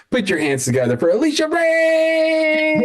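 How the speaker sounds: high, drawn-out emcee call; big rising stage announcement; show host introduction. Real, not acted. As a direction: bring the act on with a soaring, stretched name. Host calls the next act on.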